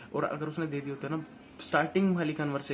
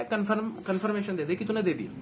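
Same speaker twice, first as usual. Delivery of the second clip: demanding confirmation; 6 semitones higher, 5 dB louder than usual. Retold or imitated.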